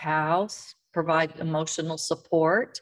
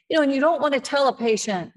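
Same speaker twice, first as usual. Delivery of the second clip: excited burst; 7 semitones higher, 5 dB louder, faster than usual. Real, not acted. Emphatic aside, rising.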